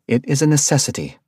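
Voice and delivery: correcting firmly; corrective insistence. Acted. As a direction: set him straight, insistent. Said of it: "she corrected".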